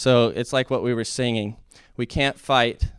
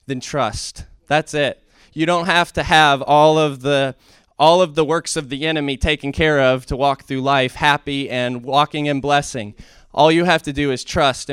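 higher and louder, driving exhortation; fervent preaching; 4 semitones higher, 6 dB louder than usual. Acted. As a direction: urge the congregation on. Preaching build on trust.

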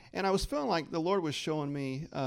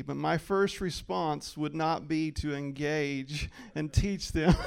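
ordinary talk

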